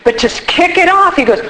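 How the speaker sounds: usual speaking voice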